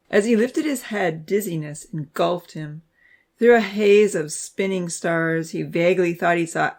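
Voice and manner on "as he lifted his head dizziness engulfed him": ordinary delivery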